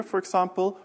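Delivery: normal talking voice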